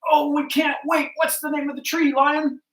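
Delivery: high, loud, eager animal chorus; excited crowd. Acted. Animals greet Lion back from the mountain, high and excited. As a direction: hail the returning hero with breathless eagerness.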